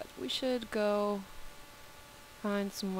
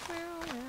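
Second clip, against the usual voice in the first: mock meow; 9 semitones higher, 4 dB quieter than usual. Playful cat noises.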